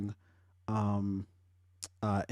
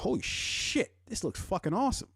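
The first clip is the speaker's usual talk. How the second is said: mock amazed fans; 10 semitones higher than usual. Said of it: "everybody's like".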